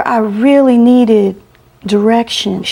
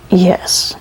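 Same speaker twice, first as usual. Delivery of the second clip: faint whisper. Retold or imitated. Dying woman who can barely talk.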